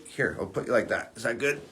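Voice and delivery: gentle coaxing aside, away from the audience; soft aside to dog. Real, not acted. Settling the dog on her lap.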